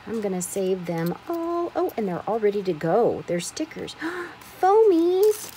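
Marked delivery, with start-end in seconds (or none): 4.0-4.6 sharp, breathy intake of surprise; real little gasp of delight. Real, not acted. 4.6-5.5 higher, louder delighted squeal of the word; real giddy excitement; excited. Real, not acted. Finds foam stickers in package.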